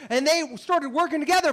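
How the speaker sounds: ordinary talk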